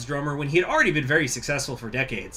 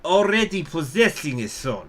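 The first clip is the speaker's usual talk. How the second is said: slow, grave storyteller read; dramatic reading; slower than usual. Acted. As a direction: read it like an epic narrator. Reads the event text aloud.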